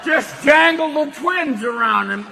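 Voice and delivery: loud folksy aw-shucks voice; Jimmy Stewart. Retold or imitated. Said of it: "Did Jimmy Stewart basically go".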